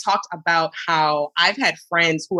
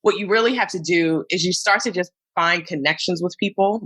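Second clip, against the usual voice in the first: ordinary talk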